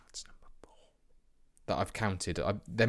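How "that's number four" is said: whisper. Real, not acted. Muttering aside while counting.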